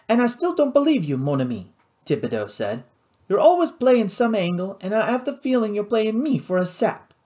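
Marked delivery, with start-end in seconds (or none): 0.1-1.7 quick, high Cajun complaint; distrustful pirate boss. Acted. Louis telling Nathan he doesn't believe him, distrustful. 3.3-7.1 higher-pitched, accusing Cajun pirate; distrustful pirate boss. Acted. Louis accusing Nathan of playing him, distrustful.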